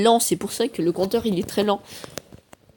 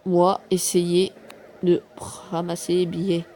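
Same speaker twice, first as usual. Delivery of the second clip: ordinary delivery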